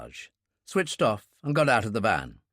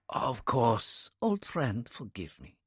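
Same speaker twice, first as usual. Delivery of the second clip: hoarse, softer apologetic voice; 2 semitones higher, 3 dB quieter than usual. A narrator's reading. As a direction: say it hoarsely, shaken and ashamed. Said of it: "He said hoarsely".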